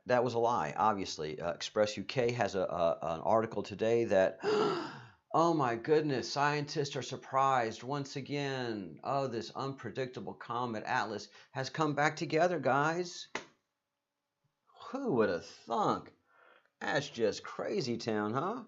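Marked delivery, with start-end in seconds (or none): 4.4-5.0 a big, sharp, high gasp of fake shock; mock gasp. Host gasps mockingly at the news of scientists being surprised. 5.3-18.6 sarcastic astonishment, pitched higher; mock surprise. Host mocks surprised scientists, in sarcastic wonder. Sarcastic reaction to comet news.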